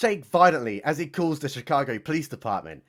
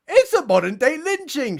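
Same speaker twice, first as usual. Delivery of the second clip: high, loud outraged cry; mock shout; 9 semitones higher, 4 dB louder than usual. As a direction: proclaim it like a campaign soundbite. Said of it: "shouts presidential hopefuls".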